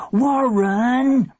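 high, drawn-out scolding cry; long high shout of protest; exasperated yell. A narrator's reading. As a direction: yell the name at a pesky brother. Sister shouts as he yanks cord.

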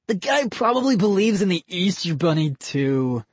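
mock a gullible kid. Boy character voice.

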